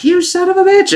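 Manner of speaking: mock outraged cry, very high-pitched; Spandam. Recapper mimicking the villain cursing his attackers, mock high outrage. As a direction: imitate a villain's high squawk of fury. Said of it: "And Spondom's like".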